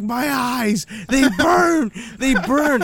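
imitating a drunk friend in pain; whiny drunk friend. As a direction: moan that your eyes burn. Drunk friend whining, eyes burning.

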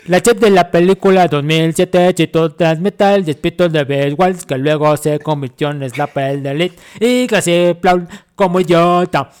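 loud commanding general reading. Acted. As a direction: announce it like an army general. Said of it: "que lo lea el general".

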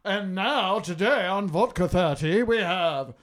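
introducing the co-hosts, rising to loud whooping hype; big show-intro shout, names stretched out; announcer hype. Pretend. Host introducing the podcast crew, hyped announcer.

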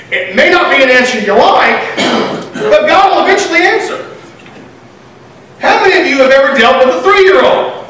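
normal talking voice

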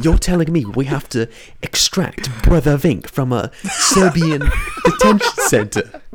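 loud, high, laughing outrage; laughing disbelief. Real, not acted. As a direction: crack up while protesting.

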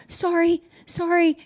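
small, high-pitched "sorry"; high, reflexive little apologies; imitated anxious apology. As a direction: mimic a girl who apologizes for everything, high-voiced. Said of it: "you could hear it in her".

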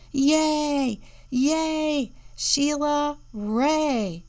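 high, loud cheering; cheering yell. A narrator's reading. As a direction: cheer her on like a crowd of kids. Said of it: "her friends yelled".

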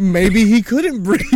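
laughing as he repeats it. Retold or imitated. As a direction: laugh out the realization. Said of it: "I was like".

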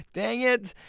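high-pitched exclamation of defeat; mock frustration. Storyteller answers the pitying people, in high mock frustration. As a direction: squeal it in comic exasperation. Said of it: "I'm like".

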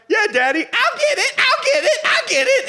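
put-on very high, loud, laughing child voice; eager little kid. Preacher voicing his son eagerly answering his dad, high and giggly. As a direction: squeal it like a kid desperate to please.

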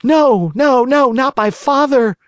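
high, loud frantic plea; terrified cry. A narrator's reading. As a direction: beg in wild terror. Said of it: "cried Pinocchio, wild with terror".

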